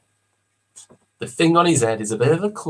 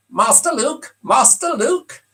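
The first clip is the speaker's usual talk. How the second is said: high, slow, fussy robot butler; prissy anxious droid calling out; 9 semitones higher, slower than usual. Acted. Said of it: "Who am I doing there?".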